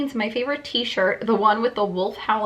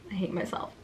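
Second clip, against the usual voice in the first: mock despair; 2 semitones lower, 10 dB quieter, faster than usual. Real, not acted. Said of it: "cringe at own writing".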